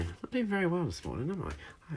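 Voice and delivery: mock self-scolding. Teacher mock-scolds herself.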